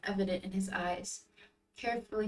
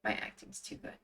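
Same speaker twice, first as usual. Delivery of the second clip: muttered aside; 7 dB quieter than usual. Real, not acted. Aside on own crying act.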